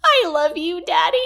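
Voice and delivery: put-on high, giggly child squeak; a child squeaks to her daddy through giggles; squeaky little girl. A narrator's reading. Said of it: "she manages to squeak".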